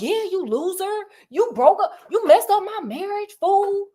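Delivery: high, sassy, accusing rant; furious widow. Retold or imitated. Widow confronting the blackmailer who ruined her marriage, furious. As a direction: tell him off for ruining your marriage. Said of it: "And she's like".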